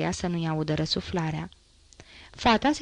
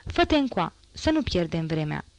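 ordinary delivery

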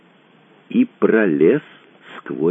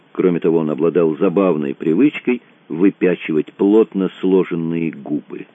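plain reading voice

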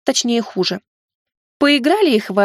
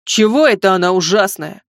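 indignant yell; 3 semitones lower, 4 dB louder than usual. Pretend. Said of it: "возмущённый вопль сверху".

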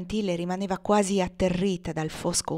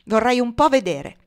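loud, higher taunt; defiant challenge; 5 semitones higher, 7 dB louder than usual. A narrator's reading. Legree daring anyone to stop him, loud defiance.

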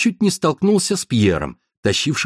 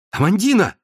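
higher call across the yard; 8 semitones higher than usual. Acted. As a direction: call her name from afar. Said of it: "закричал он". (громко окликнуть)

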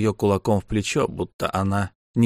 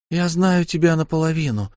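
put-on raised young woman's voice, read for Lyu; light female character voice; Lyu's voice; 7 semitones higher than usual. A narrator's reading. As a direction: voice Lyu a step higher. Lyu speaks.

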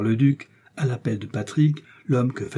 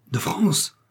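higher-pitched, startled question; surprised exclamation; 7 semitones higher than usual. A narrator's reading. Buckingham forgets all at news from France, surprised exclamation. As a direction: seize on the news, amazed. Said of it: "s'écria Buckingham".

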